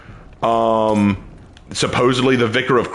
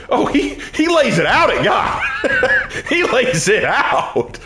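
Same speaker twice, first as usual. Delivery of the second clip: laughing; 10 semitones higher, slower than usual. Real, not acted. Laughing about Job ranting at God.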